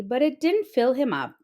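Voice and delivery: ordinary delivery, nothing marked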